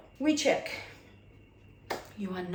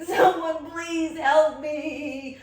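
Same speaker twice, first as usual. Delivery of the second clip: slow, loud, drawn-out plea for help; desperate plea; 8 semitones higher, 9 dB louder, much slower than usual. Retold or imitated. To Chris while in labor, a slow loud desperate plea. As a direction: beg for help as if in agony. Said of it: "I'm hanging off Chris and I'm like".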